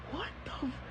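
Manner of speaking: high, hushed incredulous reaction; retold silent outrage, cut short; inner disbelief. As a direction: swallow the curse in mock disbelief. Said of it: "In my head, right?".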